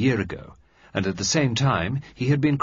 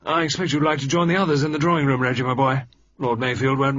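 put-on brisk, louder, higher old officer's voice; Sir George; 5 semitones higher, 5 dB louder, faster than usual. A narrator's reading. Sir George sending young Reggie off to the ladies, brisk and loud. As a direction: bluff old admiral dismissing the boy quickly. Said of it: "Then Sir George said".

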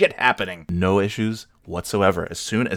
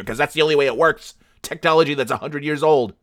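exasperated; 5 semitones higher, 4 dB louder than usual. Real, not acted. Ranting about old tape recorders.

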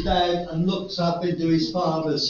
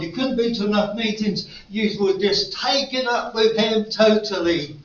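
loud, higher urgent appeal; preaching at full force; 5 semitones higher, 5 dB louder than usual. Acted. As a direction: press the congregation to respond. Altar call at full force.

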